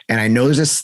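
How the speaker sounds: normal talking voice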